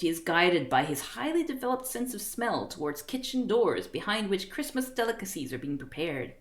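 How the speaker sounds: ordinary talk, nothing marked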